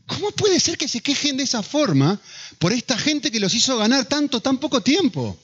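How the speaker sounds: incredulidad. Acted. Acted disbelief at whistling fans.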